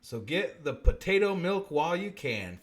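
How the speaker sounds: normal talking voice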